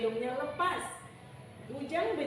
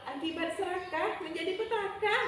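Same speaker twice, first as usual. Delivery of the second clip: higher-pitched lightly voiced bidadari; heavenly maiden; 6 semitones higher than usual. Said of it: "kata bidadarinya ya". (suara bidadari yang mengejek)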